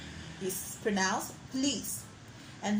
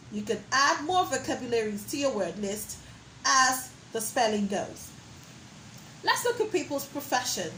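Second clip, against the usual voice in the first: ordinary talk, nothing marked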